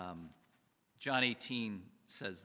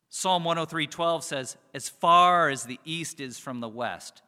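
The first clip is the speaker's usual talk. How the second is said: louder, proclaiming verse after verse; preaching scripture; 11 dB louder than usual. Acted. Pastor booming scripture to the congregation, preaching at full force. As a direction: declare God's promises like a herald.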